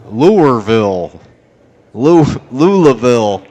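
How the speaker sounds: loud, drawn-out mock Louisville accent; loud Louisville-accent caricature. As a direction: slur the town's name in an exaggerated local accent. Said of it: "That's what they sound like".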